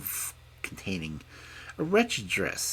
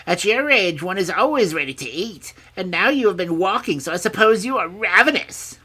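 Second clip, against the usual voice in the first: high, loud crone voice; carping old woman; 13 dB louder than usual. A narrator's reading. Old woman supposing the princess is hungry, carping.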